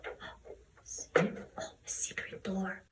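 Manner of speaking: hushed whisper of discovery; very soft, conspiratorial aside; secretive whisper. Acted. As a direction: whisper as if letting someone in on a secret. Showing a hidden secret door.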